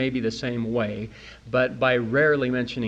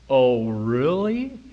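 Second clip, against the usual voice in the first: sarcastic disbelief; 4 dB louder, much slower than usual. Acted. Sceptical retort to Hollywood.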